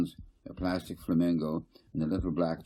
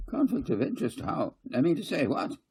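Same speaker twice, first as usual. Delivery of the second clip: high, indignant sputter; a flustered councillor caught out; flustered outrage; 11 semitones higher than usual. A narrator's reading. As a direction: sputter it out in shock. Kading flustered at accusation.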